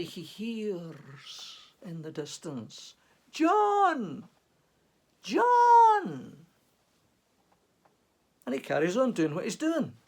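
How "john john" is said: high, loud call across the glen; distant Scottish shout of a name; calling from afar. Acted. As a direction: call his name out over the hills. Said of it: "complete with the accent".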